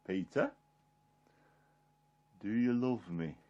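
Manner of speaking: low, very soft asking; hushed, tender retold question; gentle question. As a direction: ask quietly, leaving a long pause after the name. Jesus asks a third time.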